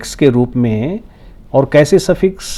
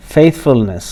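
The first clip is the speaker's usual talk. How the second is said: "faithfulness" with FAITH stressed, the rest falling; first-syllable stress; much slower than usual. Shown as a demonstration. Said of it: "put stress on the first syllable". ("faithfulness" के पहले syllable पर ज़ोर)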